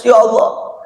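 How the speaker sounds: lightly acted mourning prayer; tearful prayer. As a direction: plead to God as a grieving companion. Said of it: "semua nangis".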